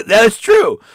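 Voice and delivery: high, loud delighted agreement; amused exclamation. Real, not acted. Host agreeing with a viewer, loud amused burst. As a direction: burst out agreeing straight after a laugh. Laughing reaction to a viewer comment.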